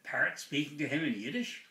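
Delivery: ordinary talk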